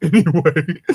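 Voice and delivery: ordinary talk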